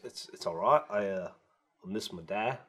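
soft, low retelling of a homesick friend; understated teary impression, low and slow; a little teary. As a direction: quietly imitate someone mildly upset, haltingly. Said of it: "he got a little teary".

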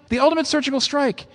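"ultimate" lifted well above the voice; a high, ringing proclamation of "The ultimate surgical strike"; lifted high for emphasis. Shown as a demonstration.

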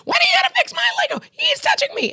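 put-on high, fast whiny child shout; shrill kids yelling from the back seat. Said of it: "the kids are like".

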